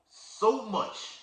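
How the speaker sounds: "So much" high and drawn out, falling; emphasis on so much. Shown as a demonstration. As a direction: lean hard on "So much". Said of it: "We gotta read it with emphasis".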